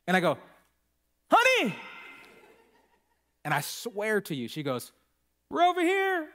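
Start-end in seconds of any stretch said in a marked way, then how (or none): 1.3-1.8 high, loud call across the store; calling for her husband's attention; yell. Retold or imitated. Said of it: "I should just yell out". 5.5-6.3 high, laughing reply from far away; lightly voiced wife answering; mock wife.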